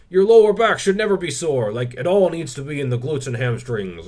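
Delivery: loud, high mock lecture, easing off; Squat University impression. Fitness YouTuber mimicking a strict channel's advice, mock preachy. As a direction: put on a preachy rule-giver, starting high and loud. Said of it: "channels like Squat University are like".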